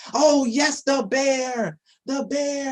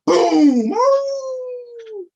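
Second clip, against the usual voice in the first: put-on a booming crash for the kids; sudden loud boom; loud boom effect; 12 semitones higher, 5 dB louder than usual. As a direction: shout the crash big. Pumpkin crash and cheer.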